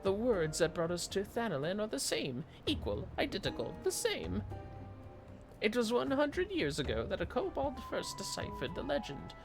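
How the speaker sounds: high, loud excitable kobold. Acted. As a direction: squeak it out like an eager little kobold. Voices a kobold NPC's dialogue.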